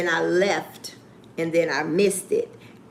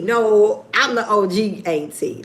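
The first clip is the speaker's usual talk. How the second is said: ordinary talk, nothing marked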